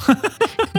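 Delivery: short rising giggle, pitch up high; high laugh. Real, not acted. Host giggling about saving a meal on vacation. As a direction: let a quick high laugh out.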